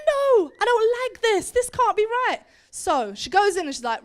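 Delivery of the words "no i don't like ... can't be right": high, loud objection; indignant protest. Acted. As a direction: protest hotly with pitch raised. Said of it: "And she was like".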